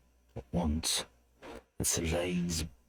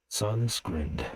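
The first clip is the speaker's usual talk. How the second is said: plain reading voice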